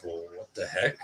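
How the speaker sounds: ordinary delivery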